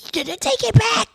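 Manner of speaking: high-pitched mock mother voice; scolding mother. Mother reproaching her daughter, high-pitched mock dismay. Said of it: "My mother said".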